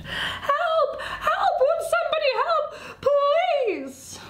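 very high, wailing distress call; panicked cry for help. Acted. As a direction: wail for help in a high, crying voice. Mouse cries out for help.

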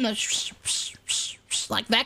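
whispered sh-sh-sh of rubbing thighs; imitated swishing. Shown as a demonstration. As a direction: hiss out the sound of thighs rubbing. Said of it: "You know, the swishing".

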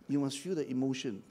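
ordinary talk

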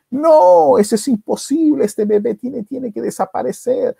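high, emphatic acted insistence; vehement refusal. Brother insisting the baby must disappear, vehement. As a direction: refuse outright, pitched high.

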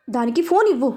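plain reading voice

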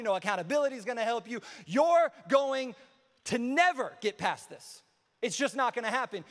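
emphatic accuser, slightly in character; accusing Satan. Acted. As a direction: pile up discouraging verdicts, each one driven home. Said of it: "lies that Satan will tell you".

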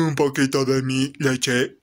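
put-on child's voice suddenly dropped low; changing voice. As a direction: drop deep, as if puberty just hit. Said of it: "mi voz está cambiando".

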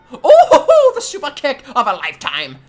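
high, loud laughing whoop; excited whoop. Real, not acted. Player cheering the super kick of a lifetime, excited.

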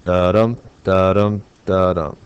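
"dadum" as an even beat, landing on dum; iambic beat. Shown as a demonstration. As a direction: chant each "dadum" with a weak da and strong dum. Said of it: "That would be three dadums".

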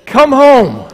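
loud, high shouted command. Retold or imitated. Wife orders husband home by phone, shouted command voice. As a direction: imitate a wife's urgent, hollered order. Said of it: "she used her ca-, man voice".

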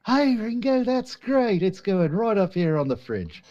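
very high put-on voice, mock praise; patronizing bandmate. Bandmate praising the drummer's song, high patronizing mock voice. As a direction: humour him like a child with a drawing.

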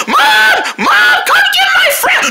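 very high squealed yell for mom; shrieking high call for help; tattling to mom. Acted. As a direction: scream for mom like a tattling kid. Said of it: "I'm telling my mom".

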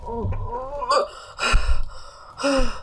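panicked gasping. Real, not acted. Nearly drowned in the game.

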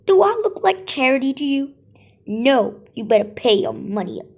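loud, fast angry refusal; angry vendor. Acted. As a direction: snap at the customer quickly. Vendor refuses to give soup.

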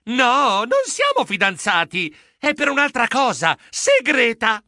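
mildly flustered, emphatic correction; flustered stammer. Acted. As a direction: protest awkwardly, raising the pitch. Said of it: "Io balbettai".

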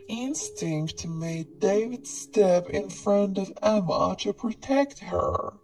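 ordinary delivery, nothing marked

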